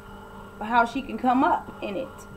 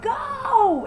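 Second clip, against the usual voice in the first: Go! shrieked very high and loud; mock her shriek, pitch jumping way up on Go!; shrill scream; 14 semitones higher, 3 dB louder than usual. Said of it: "you hear her screaming".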